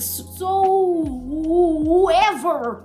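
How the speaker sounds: long, high drawl on "whoever"; exaggerated slow, rising-pitch drawl; drawn-out whoever. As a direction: stretch "So whoever" out very slowly. Stretches a fumbled name mockingly.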